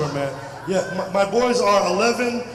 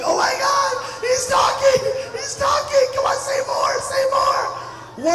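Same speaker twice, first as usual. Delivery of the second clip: much higher, squealing with excitement; excited parent; slower than usual. Retold or imitated. A parent urging a first-talking baby, high excited squeal. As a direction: gush like a thrilled parent. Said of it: "parents get so excited".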